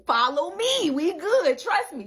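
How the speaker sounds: loud, high, confident reassurance; voice of the divine. Retold or imitated. The divine reassuring her, confident and loud. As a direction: play the divine calming her down. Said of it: "Divine like".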